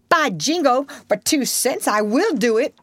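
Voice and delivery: high, hot-tempered threat; boyish threat. Acted. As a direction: bristle up high like a boy spoiling for a fight. Tom threatens the new boy.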